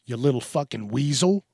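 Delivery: usual speaking voice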